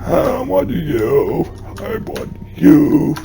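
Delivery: slow, ghostly, eerie; creepy whisper voice. Acted. Game ghost calling to the player, eerie and slow. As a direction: breathe it like a ghost.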